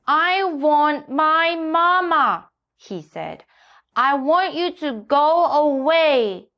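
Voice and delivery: put-on sulky, slow child whine; pouting. A narrator's reading. Pouting donkey tells the hen to go away. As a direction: sulk at the sitter.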